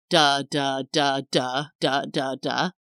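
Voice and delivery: even, droning beats on one note; monotone. Shown as a demonstration. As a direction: drone each syllable identically. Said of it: "If you speak in a monotone way".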